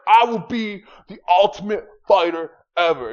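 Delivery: high, loud hammed-up boast; boastful fighter. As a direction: puff up like a cocky game fighter. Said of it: "He's like".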